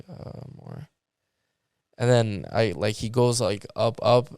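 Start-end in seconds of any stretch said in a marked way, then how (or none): 0.1-0.9 tiny, barely voiced reply; little voice. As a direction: shrink it to a very soft murmur. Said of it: "with a little voice".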